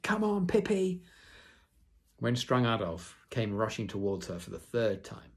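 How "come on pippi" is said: high, loud cheering yell; children cheering. Acted. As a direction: cheer your friend on. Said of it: "and shouted".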